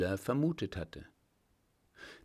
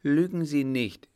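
higher, louder indignant retort; flaring up; 5 semitones higher, 7 dB louder than usual. A narrator's reading. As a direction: flare up at the accuser. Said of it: "fuhr sie auf". (aufbrausend, empört)

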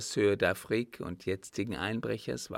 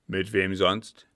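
put-on loud old captain pressing on; forceful interrogation; gruff demand; 2 semitones lower, 8 dB louder than usual. A narrator's reading. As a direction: demand a name, voice raised.

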